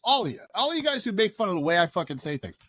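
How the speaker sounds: ordinary talk, nothing marked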